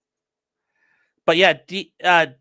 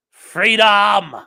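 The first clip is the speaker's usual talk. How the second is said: loud Scottish war cry; Scottish battle cry; 6 semitones higher, 8 dB louder than usual. Retold or imitated. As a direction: shout it like a Highland rebel. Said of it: "We need to work on your Scottish accent there".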